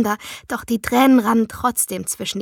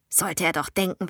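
ordinary delivery

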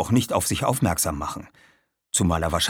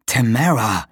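a touch of anger, slightly raised voice; angry protest; 3 semitones higher, 4 dB louder than usual. A narrator's reading. Aaron protests at being held back, mildly angry. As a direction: say the name as a reproach. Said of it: "Aaron sah sie wütend an".

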